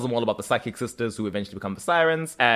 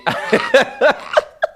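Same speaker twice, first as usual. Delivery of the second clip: high mock cackle; 7 dB louder than usual. Teller cackles as villain.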